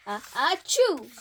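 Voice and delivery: sudden acted achoo; sentence-breaking achoo; loud mock sneeze. A narrator's reading. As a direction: burst out with a loud fake sneeze. Elephant sneezes mid-sentence.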